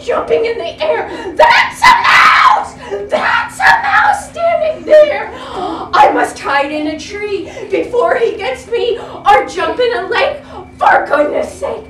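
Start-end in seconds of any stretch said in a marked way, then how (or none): 1.4-11.8 high, loud panicked cries; frightened shout. Acted. Elephant shrieking in fear at the little mouse. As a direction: scream in terror, begging. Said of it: "shouted the elephant".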